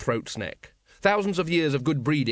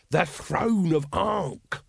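very slow, raised pitch; patient explaining; 5 semitones higher, much slower than usual. A narrator's reading. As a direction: spell it out word by word. Patient vendor explains to captain.

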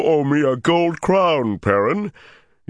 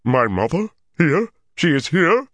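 alarmed, rising higher and louder; panicked Ogier; 13 semitones higher, 4 dB louder than usual. Acted. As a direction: giant in sudden panic. Ogier panics at mother's name.

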